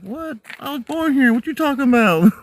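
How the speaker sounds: high, mock-offended disbelief; mock indignant. Retelling his reply to Saint Louis locals, mock indignant. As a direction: play it up, acting insulted. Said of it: "I'm like".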